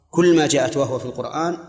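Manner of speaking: ordinary talk, nothing marked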